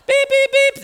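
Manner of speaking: high, loud repeated beeps; imitated machine alarm. Donation machine going off mid-session, imitated beeping. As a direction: mimic a shrieking monitor alarm.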